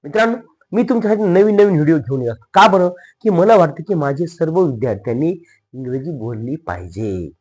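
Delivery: ordinary delivery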